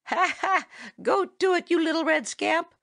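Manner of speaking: high, hearty call with a laugh; shouting. A narrator's reading. Farmer Brown's boy calls after Chatterer, shouting. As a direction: call out from behind, teasing. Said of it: "shouted a voice behind him".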